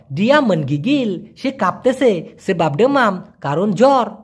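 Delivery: ordinary talk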